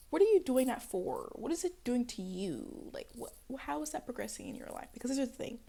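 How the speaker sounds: usual speaking voice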